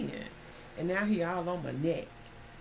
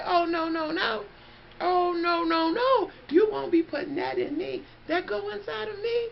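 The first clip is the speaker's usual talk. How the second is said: high, loud frantic no; shrill frantic mock alarm; panicked refusal; 12 semitones higher, 5 dB louder than usual. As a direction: plead in rising panic. Panicked refusal in retold scene.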